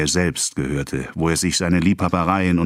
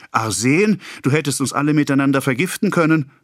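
higher, frightened rebuke; horrified; 8 semitones higher than usual. A narrator's reading. Wife horrified at the arsenic, higher. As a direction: clutch at the danger.

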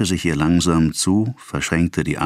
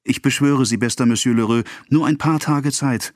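high, urgent plea; implore, near tears but without sobbing; acted desperate entreaty; tearful begging; 8 semitones higher than usual. A narrator's reading. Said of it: "Sie schluchzte".

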